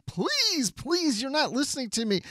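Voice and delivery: high, soft mock pleading; a hammed-up desperate plea; pleading Neelix.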